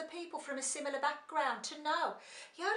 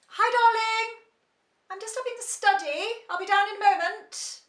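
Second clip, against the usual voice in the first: higher, louder plummy call upstairs; posh voice to husband; 9 semitones higher, 12 dB louder than usual. Acted. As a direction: call down sweetly in her posh voice. Calling to her husband.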